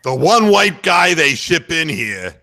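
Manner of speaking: mock despair of a gruff commentator, slow and drawn out; Tommy Heinsohn impression. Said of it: "I wonder if Tommy would have said".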